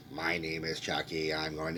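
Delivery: low, soft mock robot voice. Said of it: "is he gonna have a stereotypical robot voice?".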